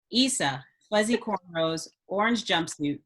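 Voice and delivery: usual speaking voice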